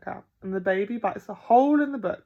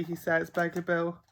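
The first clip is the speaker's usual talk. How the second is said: plain reading voice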